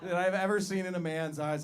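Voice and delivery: ordinary talk, nothing marked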